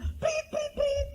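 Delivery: very high, shrill whistle blasts; mock whistle blasts from a traffic cop; police whistle imitation. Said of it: "Tapi bunyinya".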